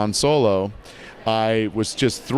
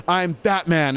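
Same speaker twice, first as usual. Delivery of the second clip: higher, youthful hero read; lighter Batman voice; 6 semitones higher, slower than usual. Shown as a demonstration. Director approving the higher grown-up Robin Batman. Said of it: "There it is".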